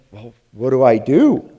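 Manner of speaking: what-now plea; at a loss. Retold or imitated. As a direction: act out being stumped, pushing the last words. Retelling his own helpless question.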